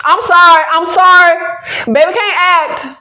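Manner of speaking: loud, high and unapologetically emphatic; emphatic insistence. Real, not acted. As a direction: insist on it, pitched well up. Emphatic apology, then repeats the verdict.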